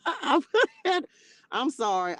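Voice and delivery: breaking into real laughter; laughing. Real, not acted. Host cracking up at a viewer's comment, laughing. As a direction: apologize through giggles.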